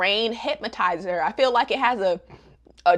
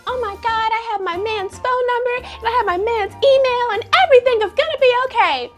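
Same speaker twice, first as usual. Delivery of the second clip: hammed-up ecstatic gushing; mock gushing happiness; 12 semitones higher than usual. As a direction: imitate an overjoyed lovestruck girl. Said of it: "she's, like, so happy".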